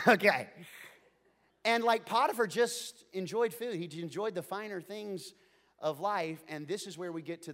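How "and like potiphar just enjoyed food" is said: ordinary talk, nothing marked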